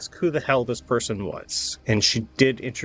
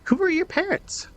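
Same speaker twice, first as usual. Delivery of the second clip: NPC questioning. Acted. GM voices NPC Morgan.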